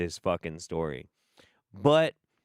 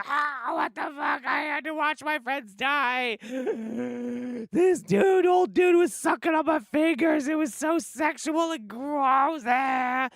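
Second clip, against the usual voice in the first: shrill, much higher mock-hysterical shrieking. Acting out Dolly's breakdown for listeners, hammed-up hysterical shrieking. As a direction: play a panicked final girl, overdone and wailing. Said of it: "hysterical and having a horrible time".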